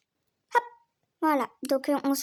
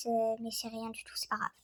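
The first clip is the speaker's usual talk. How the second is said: whispered aside; 5 semitones lower, 8 dB quieter than usual. Real, not acted. Brushing off horse's kick.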